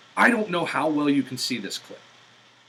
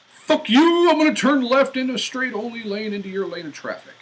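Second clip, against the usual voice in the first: mock angry driver; 10 semitones higher, 6 dB louder than usual. Voicing rude driver's thought.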